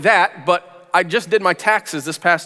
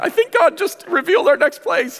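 much higher, eager announcement; excited news; 13 semitones higher than usual. Retold or imitated. Telling his wife about the call, much higher and eager. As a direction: burst out with the good news.

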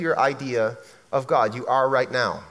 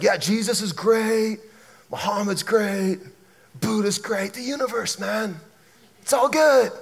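earthy hippie; 2 semitones higher than usual. Retold or imitated. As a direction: draw it out like a blissed-out hippie. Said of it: "stereotypically earthy".

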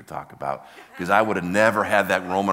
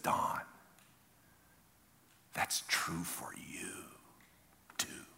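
very slow, hushed whisper; whisper of God; 14 dB quieter, much slower than usual. Retold or imitated. God speaking privately to Don, slow whisper. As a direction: whisper it slowly, with long pauses. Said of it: "The whisper of God".

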